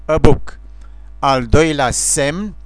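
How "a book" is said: "a" reduced to a short ă before "book"; weak schwa on "a" in "a book"; schwa article. Shown as a demonstration. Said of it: "la articolul nehotărât ă". ("a" ca ă scurt în "a book")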